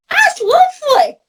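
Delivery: a high, drawn-out "that's lovely"; 1 delighted thought. Retold or imitated. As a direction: lollipop lady recalling the compliment, high and delighted. Her own thought, touched.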